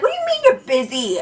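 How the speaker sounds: high-pitched incredulous retort; mock indignation. Friend back through the closed door, mock indignation. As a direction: act out being indignant.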